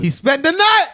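loud insistent call. Real, not acted. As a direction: raise your voice to cut in.

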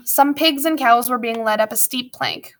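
ordinary delivery, nothing marked

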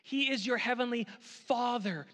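normal talking voice

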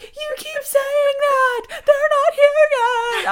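wife yelling at her husband during labor; upset yell. Retold or imitated. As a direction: snap back in pain and anger. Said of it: "I was, like, so upset".

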